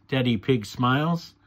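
ordinary delivery

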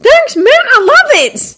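high, loud, slow gush; big squeaky boyish joy; delighted grin. A narrator's reading. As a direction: thank your friend in a thrilled kid voice. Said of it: "Still grinning".

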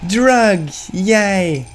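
high, drawn-out happy cheer; gleeful cheer. Real, not acted. Gamer cheering a find in the game, gleeful.

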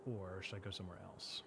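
plain reading voice

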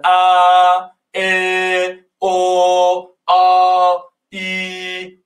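ا ا او آ ای each held long; drawn-out vowels. Shown as a demonstration. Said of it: "مصوت ها هستن".

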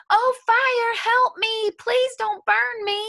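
a chicken begging the fire not to burn him; panicked plea. A narrator's reading. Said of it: "he said".

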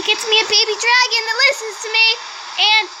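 高く早口で熱心に; 白熊に計画を語り盛り上がる; excited pleading. Real, not acted. Excited plan with polar bear.

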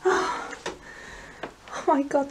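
normal talking voice

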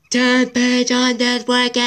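high, slow, loud yell. Real, not acted. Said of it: "Can't yell anymore".

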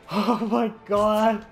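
high, loud laughing, slow and shaky; laughing so hard it almost turns to tears; helpless laughter after a fright; breathless laughter. Real, not acted. Still laughing from scare.

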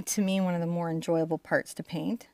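normal talking voice

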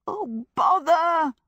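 high, slow wail of frustration; high-pitched exasperated wail. A narrator's reading. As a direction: wail it out, drawing the words long. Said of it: "wailed Sophie".

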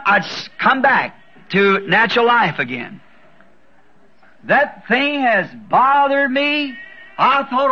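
ordinary delivery

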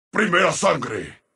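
low, ominous pronouncement; grave announcer call. Acted. Announcer declaring first blood, gravely.

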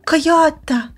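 loud high call of the name; delighted to see him; excited greeting. Acted. Player greeting the coyote, excited call.

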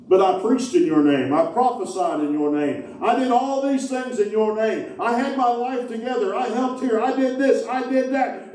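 mild high protest; a lightly voiced plea, pitched high; pleading goats. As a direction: protest your good works.

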